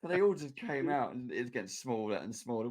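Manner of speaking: ordinary delivery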